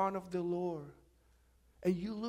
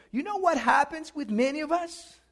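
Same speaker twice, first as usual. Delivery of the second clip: loud, higher preaching voice; full-force preaching; 5 semitones higher, 14 dB louder than usual. Acted. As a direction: drive the point home to the congregation.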